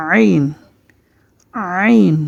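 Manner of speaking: আইন begun inside the throat; আইন squeezed from the throat; throaty Ain. Shown as a demonstration. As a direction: demonstrate আইন as the Arabic pharyngeal letter. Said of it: "গলার ভিতর থেকে".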